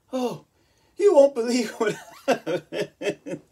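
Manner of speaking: breathless gossip, giggling through it; imitating ex-girlfriend. Ex-girlfriend about to share news.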